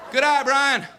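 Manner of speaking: loud, high cheer from the dugout; calling encouragement. Acted. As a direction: call out praise to the batter. Teammates call out a compliment.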